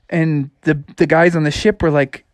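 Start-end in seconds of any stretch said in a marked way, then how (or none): none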